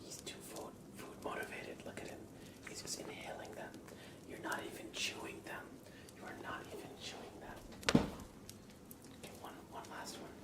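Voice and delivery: ordinary delivery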